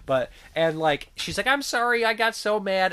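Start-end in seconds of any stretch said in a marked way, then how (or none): 1.5-2.9 high-pitched, harmless mock apology; apologetic nun imitation. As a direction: imitate a sweet nun sorry for her temper. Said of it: "her getting mad is still, like, the most tamest angry".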